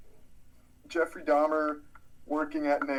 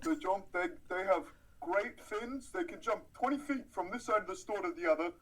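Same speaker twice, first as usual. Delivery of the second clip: lightly voiced alter ego, pitched up toward the end; Bernie; 5 semitones higher, 6 dB quieter than usual. Co-host riffing on fish jumping into the birdcage, lightly voiced character. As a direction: slip into a higher comic character voice. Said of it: "you slipped into Bernie again".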